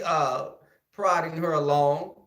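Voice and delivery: usual speaking voice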